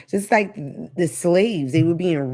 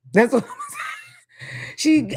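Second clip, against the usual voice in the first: laughing outburst; 12 semitones higher, slower than usual. Real, not acted.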